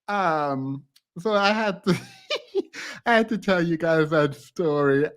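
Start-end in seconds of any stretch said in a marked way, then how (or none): 2.3-3.0 breathy giggle. Real, not acted. Laughing after telling story.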